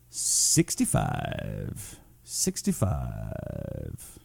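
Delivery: very low, soft, drawn-out delivery; mock-dramatic low voice. Host announcing Arizona temperature to viewers, deep mock-dramatic drawl. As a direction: stretch it out deep and slow.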